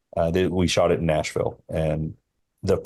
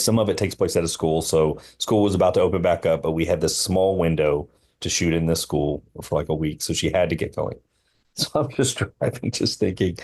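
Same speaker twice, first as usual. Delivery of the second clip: ordinary talk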